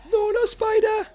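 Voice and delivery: very high, loud panicked cry; startled yelp. Real, not acted. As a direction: yelp in shock at the spider. Sudden spider attack in game.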